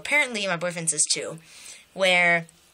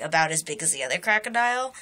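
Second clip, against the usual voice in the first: usual speaking voice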